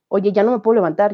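ordinary talk

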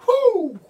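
a high, loud "whoo" of excitement; excited whoop. Real, not acted. Preacher whooping to the church, high and loud. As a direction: let out a shout of joy.

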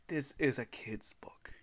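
real hushed, whispered aside; appalled whisper. Real, not acted. Reader appalled by the kids' book, whispering. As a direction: whisper it in disbelief.

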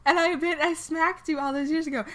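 high, indignant Sally reminding him; high, indignant voice reminding Harry of the past. Acted.

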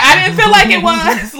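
loud and high, incredulous; telling Robin she didn't snap, loud; loud retort. Retold or imitated. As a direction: insist you didn't snap. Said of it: "I was like".

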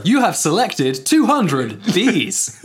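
slow robotic confirmation; automated system reading back; phone menu voice. Pretend.

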